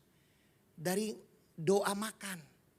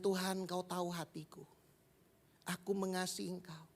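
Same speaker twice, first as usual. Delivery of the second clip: soft humble answer; 3 semitones lower, 9 dB quieter than usual. Acted. Peter answers Jesus humbly.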